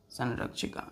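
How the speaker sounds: संरक्षिका whispered softly; teacher whispering संरक्षिका to pupils; whispered repeat. Shown as a demonstration. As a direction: breathe संरक्षिका out quietly. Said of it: "unvoiced repeat".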